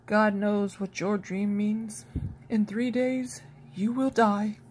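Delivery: slow, gentle sad telling; sadly. A narrator's reading. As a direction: Joseph sadly foretells death. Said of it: "Joseph said sadly".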